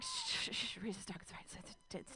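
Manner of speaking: breathy shushing, no voice at all; hushing whisper. Retold or imitated. Whispering to soothe a nervous dog, hushed.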